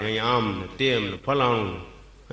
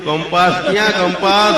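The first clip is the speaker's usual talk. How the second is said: punchline question; 4 semitones higher, 8 dB louder, slower than usual. Retold or imitated. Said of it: "મેં કીધું".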